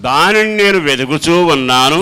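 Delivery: loud, fervent scripture repeat; preaching at full force. Acted. Preacher echoing the psalm reading to the congregation, loud and fervent. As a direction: proclaim the verse back with fervour.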